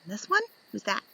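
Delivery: plain reading voice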